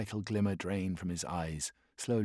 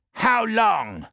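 much higher and louder, over engine roar; impatient shout; 13 semitones higher, 10 dB louder than usual. Acted. Warsmith shouting over the Thunderhawk's roar. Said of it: "asked the question again over the roar".

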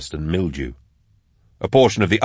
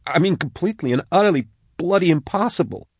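much higher, emphatic protest; agitated disbelief; 11 semitones higher than usual. A narrator's reading. Max insists on what cannot be, agitated disbelief. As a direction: pitch it high, stressing each intensifier.

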